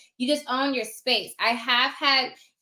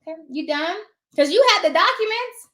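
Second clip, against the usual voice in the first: high, loud pointed question; sassy challenge; 5 semitones higher, 5 dB louder than usual. Acted. Notary cutting off a talkative realtor, sassy and loud. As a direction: call out the chatty realtor. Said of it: "and say".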